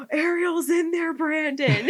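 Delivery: high, loud, laughing alarm; alarmed cry. Retold or imitated. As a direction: shout it as if you just saw a ghost. Retelling her alarmed cry to Brandon.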